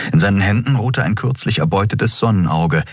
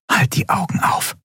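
high, hushed instruction; whispered order; 12 semitones higher than usual. A narrator's reading. Endriel telling Miko to keep watch, whispering.

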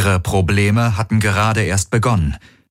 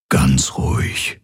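slow, soothing whisper; 6 semitones lower, slower than usual. A narrator's reading. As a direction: whisper slowly to calm someone in pain. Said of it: "flüsterte er".

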